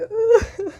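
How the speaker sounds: high loud laugh; loud burst of laughter. Real, not acted. Laughing loudly after the old lady's comment.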